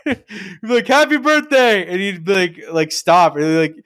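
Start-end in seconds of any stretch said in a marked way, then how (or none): 0.9-1.9 much higher, exaggerated birthday cheer; mock cheery greeting. Kids greeting Ned once more, teasing cheer. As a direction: pipe it up to tease him.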